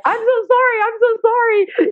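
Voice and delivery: very high, squeaky imitation of a friend; imitating girlfriend. Girlfriend beside her at the pool, high mock-wailed apology. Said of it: "my girlfriend who's beside".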